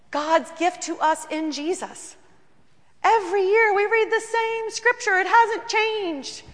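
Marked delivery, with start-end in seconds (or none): 3.0-6.4 high, loud ringing emphasis; preaching. Acted. Pastor to the congregation, high and ringing. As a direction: proclaim it slowly.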